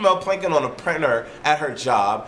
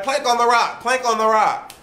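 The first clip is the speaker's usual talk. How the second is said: put-on high, teasing girly voice; mimicking the women goading their friend, high and sing-song; mocking giggly women; 8 semitones higher than usual. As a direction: imitate the women egging her on. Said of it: "And they're like".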